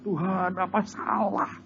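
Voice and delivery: high whiny complaint to God, hammed up; mock despairing prayer. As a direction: wail to God about a fierce wife. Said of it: "Sampai tobat-tobat, dia bilang". (meniru keluhan merengek kepada Tuhan)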